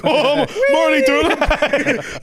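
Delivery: high, slow, laughing through the words; mock daredevil laughing, high and slow. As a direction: giggle it out as he jumps. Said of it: "And I'm like".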